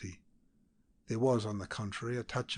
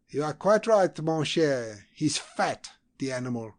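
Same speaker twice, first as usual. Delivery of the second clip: raised, loud and mocking, higher up; scornful diplomat; 5 semitones higher, 10 dB louder than usual. A narrator's reading. Diplomat mocking the fat visitor to his colleague, loud sneer. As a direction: sneer it out loud, pleased with the insult.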